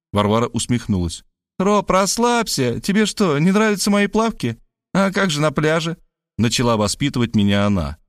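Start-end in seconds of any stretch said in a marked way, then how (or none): 1.6-6.0 teasing Varvara. A narrator's reading. Varvara teasing Rob about her briefs, high. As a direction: tease him high and easy.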